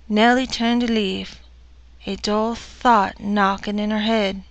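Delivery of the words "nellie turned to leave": plain reading voice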